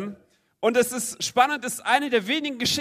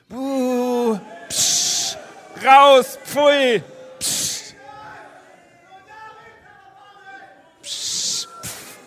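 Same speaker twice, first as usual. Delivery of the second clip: laute Buhrufe und Zischen; buhende Menge; 5 semitones higher, 4 dB louder, much slower than usual. Acted. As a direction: die Menge bringt ihn zum Schweigen. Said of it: "ihr seid jetzt die Menge". (die Menge buht den Blinden aus)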